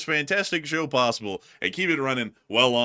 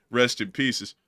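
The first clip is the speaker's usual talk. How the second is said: ordinary talk, nothing marked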